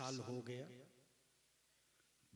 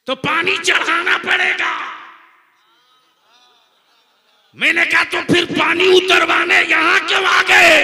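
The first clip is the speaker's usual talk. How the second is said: shouted high fiery sermon; full-force preaching; 14 semitones higher, 26 dB louder than usual. Real, not acted. Preacher shouting the punchline at the crowd. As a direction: preach it at the top of your voice.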